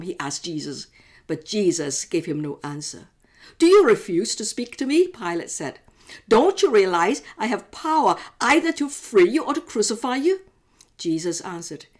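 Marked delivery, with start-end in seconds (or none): 3.6-10.4 louder, higher demanding voice; exasperated governor. Acted. Pilate to silent Jesus, an exasperated loud demand. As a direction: demand an answer, losing patience.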